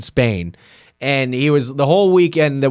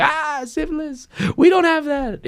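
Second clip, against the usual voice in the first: mock high voice; 13 semitones higher than usual. Friends joking with French girls, high mock voice. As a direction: coo it, playing dumb.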